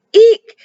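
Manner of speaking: a sudden fright squeal; shrill eek. Acted. Goblin peeking from the tree, startled squeal. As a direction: squeal high and loud. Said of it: "cried the goblin".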